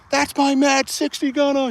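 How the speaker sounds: high, slow, loud drill-sergeant holler; mock proud sergeant screaming; imitated yell. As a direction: bellow it across the foxholes. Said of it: "He's fucking screaming in the night".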